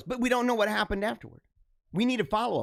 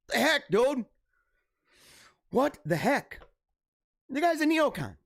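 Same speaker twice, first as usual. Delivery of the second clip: ordinary delivery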